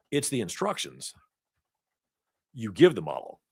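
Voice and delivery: long pauses between phrases; slow and deliberate. Shown as a demonstration. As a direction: spell it out slowly, weighing each chunk. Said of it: "I would say".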